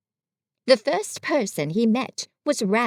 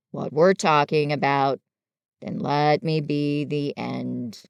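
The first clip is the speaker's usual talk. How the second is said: Eeyore, gloomy; 7 semitones lower than usual. Acted. Reader voices gloomy Eeyore.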